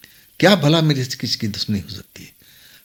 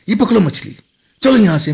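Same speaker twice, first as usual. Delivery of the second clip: higher, forceful hurrying; urgent command; 8 semitones higher, 4 dB louder than usual. A narrator's reading. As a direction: hustle him along, voice raised. Girl hurries him with the fish. (ऊँची आवाज़ में जल्दी मचाते हुए)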